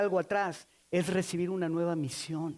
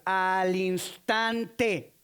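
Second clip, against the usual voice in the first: "al instante" drawn out very slowly; stressed key word; 2 semitones lower, much slower than usual. Shown as a demonstration. Preacher stressing Mark's key word "al instante" slowly. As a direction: stretch out "al instante", each syllable weighed. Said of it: "Marco enfatiza una palabra clave".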